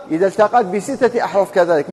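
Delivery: ordinary talk, nothing marked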